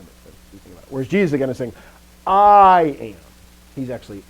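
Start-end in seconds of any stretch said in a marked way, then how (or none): 2.3-3.2 I held long, high and very loud; huge emphasis on the word I; heavy stress on I. Shown as a demonstration. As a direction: boom out the "I", then let "am" drop. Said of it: "heavily emphasizing the I part".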